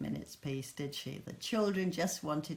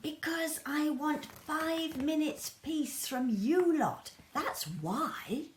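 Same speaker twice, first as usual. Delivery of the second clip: exasperated mum; 9 semitones higher than usual. Acted. Exasperated mother.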